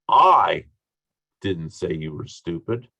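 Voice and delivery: heavy emphasis on "I", as if someone else said it; stress on I. Shown as a demonstration. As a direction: punch the word "I", then pause. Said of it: "based on what I emphasize".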